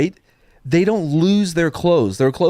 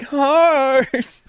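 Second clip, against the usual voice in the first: high, drawn-out mock call of Heart; goofy Heart call; 13 semitones higher than usual. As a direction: ham up the weakest hero's call. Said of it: "when he's like".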